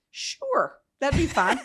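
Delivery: high, loud, comically agreeable; mock-casual acceptance. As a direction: accept with barely contained delight. Playful acceptance of governor's offer.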